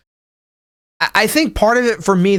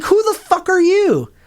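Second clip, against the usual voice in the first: much higher, indignant shout-like retort; mock outrage; 13 semitones higher than usual. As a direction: peak of the offended rant, pitched way up. Climax of angry retort.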